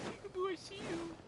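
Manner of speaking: ordinary talk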